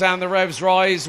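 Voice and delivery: normal talking voice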